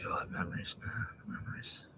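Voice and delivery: soft breathy whisper; nostalgic whisper. Real, not acted. Remembering childhood with friends, hushed whisper. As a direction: breathe it out, lost in memories.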